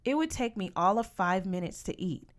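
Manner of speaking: plain reading voice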